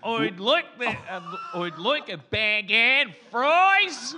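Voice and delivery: slow, loud, drawn-out Australian; exaggerated Australian accent. Shown as a demonstration. Comic trying a different phrase, overblown Australian accent. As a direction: belt the order in broad Australian. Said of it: "try it with a different phrase".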